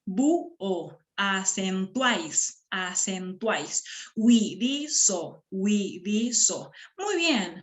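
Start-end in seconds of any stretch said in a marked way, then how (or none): 0.0-1.0 "Búho" slowly split as bú-o; "Búho" with U and O held apart; syllable by syllable. Shown as a demonstration. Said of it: "Vamos a silabear". 1.2-6.7 slow, separated syllables; drawn-out teacher's syllabification. Shown as a demonstration. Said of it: "Vamos a silabear".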